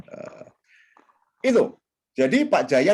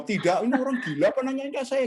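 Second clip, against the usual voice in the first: mock indignant reply; 9 semitones higher than usual. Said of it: "Pasti jawab".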